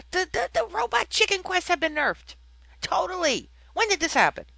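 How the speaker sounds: high-pitched, exasperated outburst; indignant rant. Real, not acted. Podcaster upset that the robot chicken quests got nerfed, indignant. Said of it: "just slightly upset about that".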